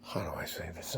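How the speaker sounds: whispered under the breath; whisper to self. Real, not acted. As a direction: hush it as a private aside. Muttering to himself mid-rant.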